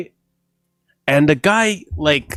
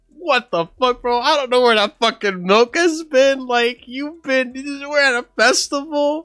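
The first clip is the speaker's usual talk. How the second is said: incredulous; 10 semitones higher than usual. Retold or imitated. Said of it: "And I'm like".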